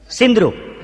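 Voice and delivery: ordinary talk, nothing marked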